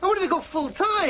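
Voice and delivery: high-pitched protest; aggrieved insistence. Acted. Son quarrels with his parents.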